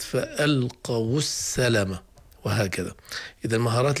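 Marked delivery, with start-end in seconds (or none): 0.0-2.0 فألقوا السلم said slowly, with the final واو of فألقوا given a damma before السلم; wau given a damma. Shown as a demonstration. Teacher slowly modelling the joining vowel. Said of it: "وأنسب حركة للواو هي الضمة، فصارت".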